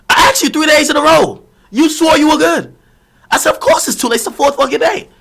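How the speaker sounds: high, loud indignant outburst; retold exasperated rebuke. Streamer replays scolding the woman who asked too late, exasperated.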